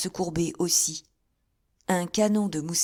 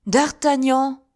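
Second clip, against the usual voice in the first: loud, high cry of recognition; surprised cry; 8 semitones higher, 9 dB louder, slower than usual. A narrator's reading. Athos and Raoul crying out on recognizing D'Artagnan. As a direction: hail the old friend with relief. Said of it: "répondirent les deux gentilshommes".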